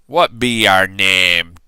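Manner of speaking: loud slow pirate question; pirate asking. A narrator's reading. Captain asking the boy his name, slow pirate voice. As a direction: ask it like a gruff captain. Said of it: "the head pirate asked".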